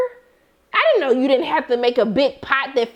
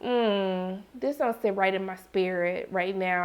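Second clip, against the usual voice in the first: uneasy mutter; 5 semitones lower, 8 dB quieter than usual. Retold or imitated. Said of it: "I was like".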